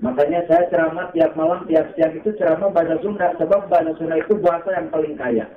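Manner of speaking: ordinary talk, nothing marked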